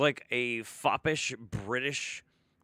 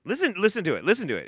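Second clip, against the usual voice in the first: louder, higher urging; urgent insistence; 7 semitones higher, 8 dB louder, much faster than usual. Real, not acted. Streamer urging chat loudly to listen. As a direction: insist they hear it.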